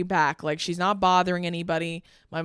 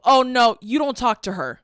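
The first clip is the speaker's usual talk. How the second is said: retold indignant cut-in, louder at first; pissed-off interruption; 4 semitones higher, 7 dB louder than usual. Daughter interrupts a man talking to her mom, pissed off. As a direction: step in firmly, mildly heated. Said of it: "because I'm pissed off".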